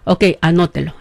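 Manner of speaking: normal talking voice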